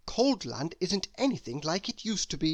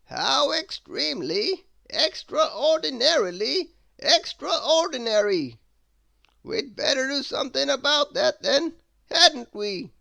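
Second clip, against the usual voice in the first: wizard; 9 semitones higher, 7 dB louder than usual. Acted. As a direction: savour every grand word like an eccentric wizard. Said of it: "exclaimed the wizard".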